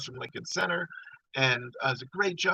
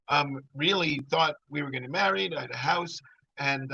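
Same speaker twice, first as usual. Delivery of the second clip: ordinary delivery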